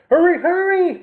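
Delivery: high, loud, eager kitten cries; excited kittens urging each other on; mewing kittens. A narrator's reading. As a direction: squeal it like racing kittens. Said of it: "mewed the kittens".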